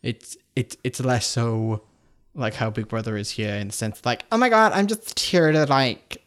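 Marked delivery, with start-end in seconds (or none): 4.3-6.2 high-pitched mock American reality-show contestant; American accent. Imitating a Big Brother contestant, American accent. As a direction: put on an American accent, higher and louder. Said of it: "why am I speaking like an American".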